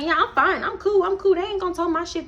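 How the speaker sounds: high, breezy brush-off; high carefree voice. Retold or imitated. Friend brushing off warning.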